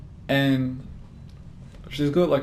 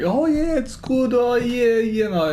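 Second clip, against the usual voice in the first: high, loud put-on Chinese accent; hammed-up Chinese accent, pitched way up; mock Chinese accent; 10 semitones higher, 5 dB louder than usual. Trying to play off the blunder, mock Chinese accent. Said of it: "like it wasn't Chinese".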